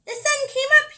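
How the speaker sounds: very high loud chirp. Acted. Little Brown Squirrel celebrating, high chirp. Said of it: "he chirped".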